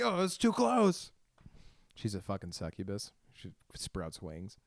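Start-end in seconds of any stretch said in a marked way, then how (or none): 0.0-1.1 much higher, louder and slow, mock alarm; mock panicked squeal. Ghost coming close in his fantasy, high mock panic. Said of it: "I'm like".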